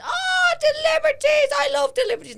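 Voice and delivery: a hammed-up delighted stranger; gushing fan. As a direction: gush like an overexcited stranger. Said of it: "they go".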